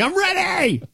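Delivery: squealed high and eager; excited fan. Retold or imitated. Fan who bought a personalized jersey, high excited squeal. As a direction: burst out like a kid on Christmas. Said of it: "I'm like".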